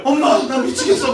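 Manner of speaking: loud, high cry to mom; hammed-up desperation; mock desperate daughter. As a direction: wail it comically at your mother. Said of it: "막 여자들도".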